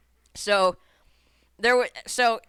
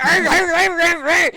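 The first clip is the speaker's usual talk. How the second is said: loud, rapid repeated roars; hammed-up tantrum noises; imitated rage growls; 7 semitones higher, 9 dB louder than usual. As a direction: growl it like a kid rattling a gate. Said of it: "starts shaking the fuck out of it".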